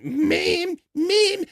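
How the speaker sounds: high squeaky cartoon kid voice; Cartman voice. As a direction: whine it like Cartman. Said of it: "Eric Cartman checks in".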